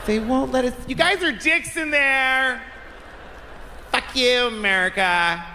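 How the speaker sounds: high-pitched caricature of a complainer. Acted. Mexican at the border to the guards, high mock complaint. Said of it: "There's a Mexican at the border".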